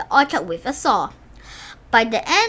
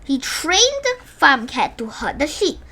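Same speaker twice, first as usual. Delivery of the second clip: plain reading voice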